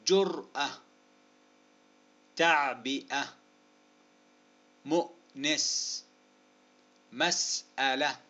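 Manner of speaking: example words spaced out and carefully articulated; deliberate word-by-word list; slow dictation. Shown as a demonstration. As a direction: dictate each word slowly, split at the hamza.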